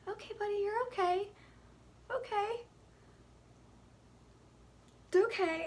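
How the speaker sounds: sweet high coo, pretending everything's fine. Real, not acted. Mom keeping cool for her choking baby, high soothing coo.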